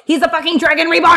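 high, exasperated exclamation; indignant outburst. Real, not acted. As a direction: burst out in disbelief at their nerve.

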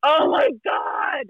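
drawn-out, slow shout of disbelief; stunned oh my God. Retold or imitated. Telling a friend her reading came true, slow stunned shout. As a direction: stretch it out, floored by the news. Hearing the ex confirm it.

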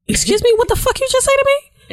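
high, incredulous outrage; indignant confrontation. Retold or imitated. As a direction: demand an answer, offended. Confronting man at the bar.

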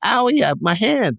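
high, squeaky wimpy guy voice; squeamish dude. Retold or imitated. Husband squealing at the sight of blood, high mock wimp. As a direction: play the fainting wimp, way up high. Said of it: "he's that dude".

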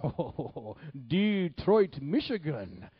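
loud, high mock-prophet boom; Mahesh Chandra. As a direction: proclaim the city grandly, imitating a visiting preacher. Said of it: "this is how he talked".

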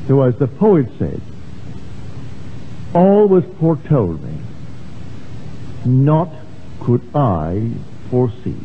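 ordinary delivery

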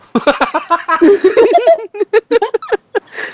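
high, helpless peals of laughter; laughing fit. Real, not acted. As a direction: crack up at your own story. Laughs at own excuses story. (家己講甲笑出來)

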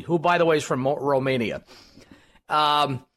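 normal talking voice

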